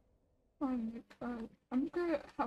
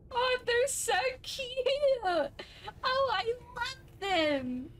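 much higher squeal, drawn out at the end; high delighted squeal over cute creatures; gushing squeal; 10 semitones higher, slower than usual. Real, not acted. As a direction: squeal with delight at something adorable.

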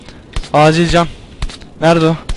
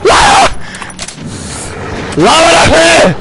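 panicked scream; 10 semitones higher, slower than usual. Real, not acted. Gamer grabbed by a monster, screaming to be let go.

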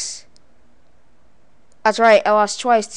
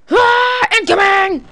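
high, loud "ah" then warning call; shouted battle cry; 10 semitones higher, 6 dB louder, slower than usual. Pretend. Player charging at game enemies, playful battle shout. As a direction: wail and holler into the attack.

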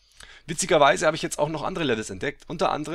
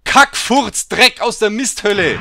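loud, high swearing at the crash; frustrated gamer rant; furious cursing; 6 semitones higher, 9 dB louder, slower than usual. Real, not acted. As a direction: spit out the curses.

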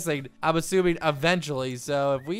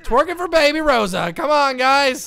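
hyped; 7 semitones higher, 9 dB louder than usual. Real, not acted. Cheering on the dance.

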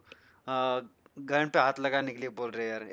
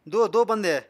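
higher, louder urgent call; urgent callout; 9 semitones higher, 12 dB louder than usual. Real, not acted. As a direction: call teammates in fast. Urgent callout mid-fight.

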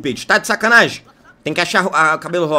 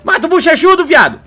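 shouting; 9 semitones higher, 5 dB louder than usual. Acted. Shouting in a game fight.

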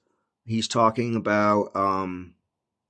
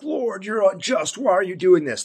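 mock complaint; 7 semitones higher than usual. Imagined believer complaining to the Lord, high mock protest. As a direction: plead up at God in a put-on high voice.

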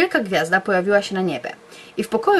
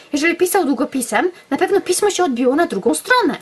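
excited explanation; 6 semitones higher than usual. Acted.

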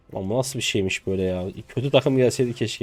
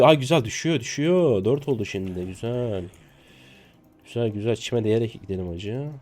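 ordinary delivery

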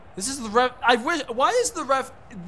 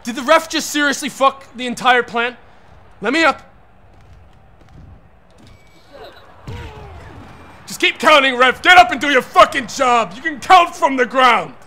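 louder, higher furious demands; angry ranting; 4 semitones higher, 7 dB louder than usual. Real, not acted. Player berating a useless game referee, angry shouting. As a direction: rant at the ref, full force.

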